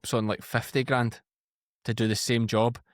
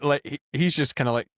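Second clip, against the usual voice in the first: ordinary talk, nothing marked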